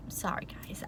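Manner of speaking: soft whisper to the viewers; whispered apology. Real, not acted. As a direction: breathe it out as an aside.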